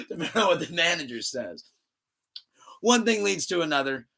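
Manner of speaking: normal talking voice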